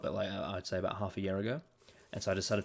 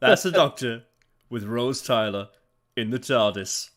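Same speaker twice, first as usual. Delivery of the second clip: game show host; 9 dB louder than usual. Retold or imitated. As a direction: boom it out like a TV host. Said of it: "it sounds like a game show host".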